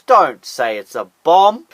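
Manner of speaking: "don't" stretched and rising; slow pleading "don't say it's a bomb"; stress on don't. Acted. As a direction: lean on "don't" slowly. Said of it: "stress on don't".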